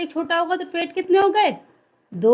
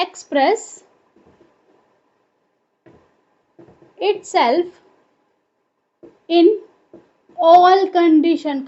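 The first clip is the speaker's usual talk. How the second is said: slow dictation, pausing between words; dictation pace; 3 dB louder, much slower than usual. Real, not acted. Teacher gives students the meaning of dominant, dictating slowly. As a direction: spell out the definition word by word, unhurried.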